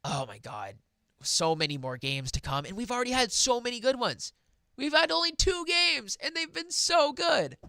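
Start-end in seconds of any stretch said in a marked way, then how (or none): 2.7-7.6 high, excited rush; thrilled, high-pitched sports fan raving. Real, not acted. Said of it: "I'm excited to see".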